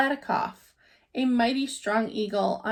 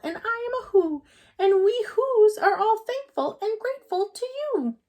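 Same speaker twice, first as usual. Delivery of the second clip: much higher, small, distant little voice; tiny Who voice; 10 semitones higher than usual. A narrator's reading. Grateful mayor thanks Horton from the dust speck, tiny high voice. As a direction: speak as a tiny grateful mayor far below.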